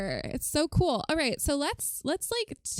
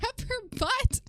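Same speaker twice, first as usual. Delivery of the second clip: laughing through the words, pitch climbing; cracking up; 13 semitones higher than usual. Real, not acted. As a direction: read it out while breaking into laughter. Cracks up while reading chat.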